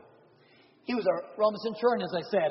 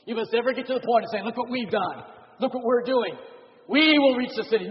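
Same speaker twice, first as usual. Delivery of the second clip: rising, louder proud boast; hammed-up church bragging; mock boastful pride; 4 semitones higher, 6 dB louder than usual. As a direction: puff up like a bragging congregation.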